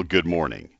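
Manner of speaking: ordinary delivery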